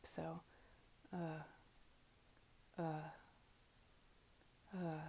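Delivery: uh voiced in the back of the throat, mouth slightly open; vocalized uh. Shown as a demonstration. Teacher demonstrating the first vowel to students. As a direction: hold uh from the throat, no lip shape. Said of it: "vocalize in the back of the throat".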